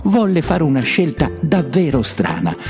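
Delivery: ordinary delivery, nothing marked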